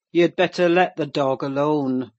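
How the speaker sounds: loud, growled order to back off; growled warning. A narrator's reading. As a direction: growl it out loudly, like a surly master. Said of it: "growled Mister Heathcliff in unison".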